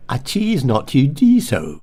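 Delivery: slow modern posh, "choose" as "cheese"; exaggerated posh, "do" said as "dee"; modern posh vowels. Shown as a demonstration. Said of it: "Choose has become cheese".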